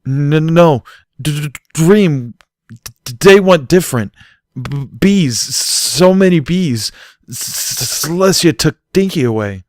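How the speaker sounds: loud, high, slow stuttering terror; a foal reliving his nightmare, stammering; panicked stammer. A narrator's reading. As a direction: force out each word through panic. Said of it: "his nostrils flaring".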